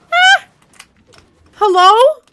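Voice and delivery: high, loud startled cry; yelp, then call out; startled shout. Real, not acted. Startled in game.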